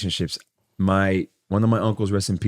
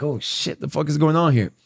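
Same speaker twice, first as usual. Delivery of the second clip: higher-pitched, mildly rattled retold reaction; a little nervous; 7 semitones higher than usual. Thirteen-year-old reacting to the girl's answer, a little nervous. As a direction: play back a nervous kid's alarm. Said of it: "like, a little nervous".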